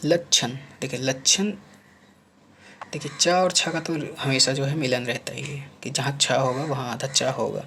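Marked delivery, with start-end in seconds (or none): none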